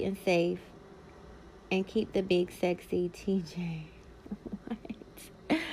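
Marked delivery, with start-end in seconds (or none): 4.7-5.6 laughing whisper. Real, not acted. Amused reaction.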